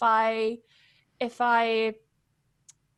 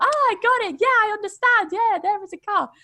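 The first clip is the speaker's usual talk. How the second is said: high, loud mock student finally getting it; relieved student; 9 semitones higher, 6 dB louder than usual. Students suddenly understanding the American version, in a high mock voice. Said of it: "And then they would say".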